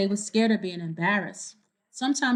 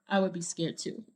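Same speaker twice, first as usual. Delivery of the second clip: usual speaking voice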